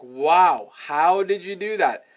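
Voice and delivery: mildly blown-away reaction, stressed HOW; amazed client. Retold or imitated. Prospect replying to the finished work, mildly amazed. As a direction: imitate an impressed client. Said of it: "they're usually like".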